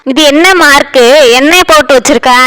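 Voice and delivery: usual speaking voice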